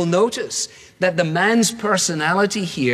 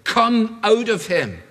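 slow, higher, firm command; stern command; 6 semitones higher, slower than usual. Acted. Jesus orders the demon out in a slow, stern command. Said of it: "said Jesus sternly".

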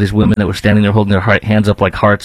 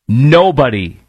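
usual speaking voice

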